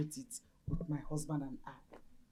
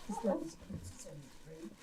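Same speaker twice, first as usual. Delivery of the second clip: ordinary delivery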